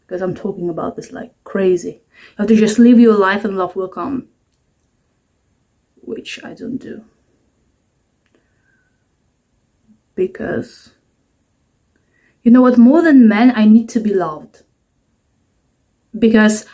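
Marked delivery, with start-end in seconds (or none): 6.0-10.9 unvoiced confession to camera; whispered aside. Real, not acted. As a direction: whisper it like a secret.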